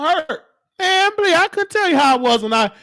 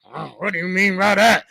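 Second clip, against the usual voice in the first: low grumbling resident; resident's retort; 5 semitones lower than usual. Retold or imitated. Resident questioning the caregiver, low imitated voice. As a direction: imitate a resident, pitched down and off your own voice.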